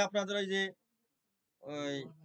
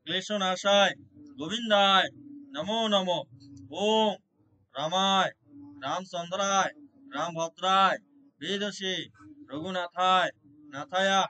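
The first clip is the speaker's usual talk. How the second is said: louder prayer, word by word with pauses; loud devotional prayer; 6 dB louder, slower than usual. Acted. Devotee praying aloud to Lord Krishna for his family, fervent loud invocation. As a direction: call out each plea to the Lord with fervour.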